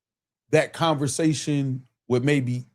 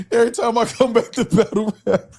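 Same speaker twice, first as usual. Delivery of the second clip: laughing while quoting; 7 semitones higher than usual. Retold or imitated. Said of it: "Hey, Verb said".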